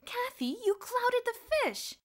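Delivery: very high, loud, slow amazed cry; excited exclamation. A narrator's reading. Boots exclaims to Kathy about the fallen headmaster. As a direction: marvel out loud at what she did. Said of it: "Boots exclaimed".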